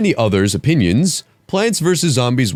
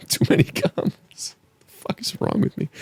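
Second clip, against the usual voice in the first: whisper; 11 semitones higher, 5 dB quieter than usual. Real, not acted. Whispered after laughing fit.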